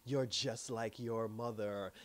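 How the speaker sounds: slow, low, sinister retold witch line. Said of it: "this sinister grin on".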